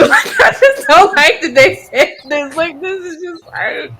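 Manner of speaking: high laughing protest; laughing in disbelief. Real, not acted.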